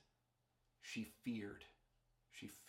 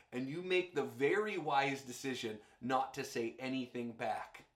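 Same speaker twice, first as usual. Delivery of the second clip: normal talking voice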